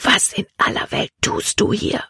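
astonished whispered question. A narrator's reading. Caleb finds the girl below deck, whispering. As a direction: whisper it in disbelief, without voice. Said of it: "Calebs Stimme war ein Flüstern".

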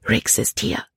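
hushed, breathy, urgent news; quick warning. A narrator's reading. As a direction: pass the warning on under your breath. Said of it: "sagte Libby schnell". (hastige, gedämpfte Warnung)

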